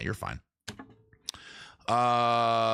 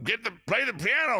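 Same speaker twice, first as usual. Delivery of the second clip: mock heckler call, higher; retold heckle; 11 semitones higher than usual. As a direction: toss out a mild mock yell from the bar crowd. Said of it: "somebody yells out".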